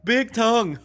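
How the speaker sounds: high, loud two-word burst; loud exclamation. Acted. Remarking on whale's tongue, high loud burst.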